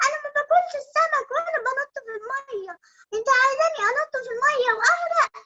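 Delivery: put-on high, fast, whiny child voice; little bunny voice. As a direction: squeak it like a hungry bunny. Bunny refuses fish.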